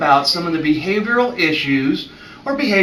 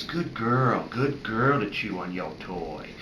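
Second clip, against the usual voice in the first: soft, lowered soothing praise; quiet praise; 4 semitones lower, 6 dB quieter, slower than usual. Shown as a demonstration. Vet praising a puppy for chewing her toy, quiet praise. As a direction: praise her low and softly. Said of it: "It's quiet praise".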